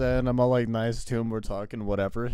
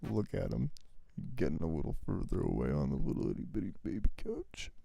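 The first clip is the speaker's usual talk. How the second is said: lower, soft unvoiced tease; teasing whisper; 4 semitones lower, 10 dB quieter than usual. Real, not acted. Roommate teasing Bailey on the little couch, in a whisper. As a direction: whisper it like you are mocking him behind his back. Teasing friend who moves away.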